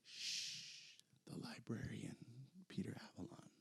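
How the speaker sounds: hushed whisper after a long "shh"; librarian whisper. Pretend. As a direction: shush the room, then whisper the name. Hushing for a librarian gimmick.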